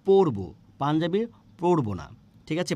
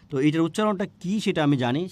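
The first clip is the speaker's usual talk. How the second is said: ordinary talk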